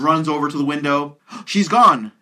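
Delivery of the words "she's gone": high, loud shocked line; shocked gasp. Retold or imitated. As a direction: blurt it out in shock. Jonathan finds mannequin gone.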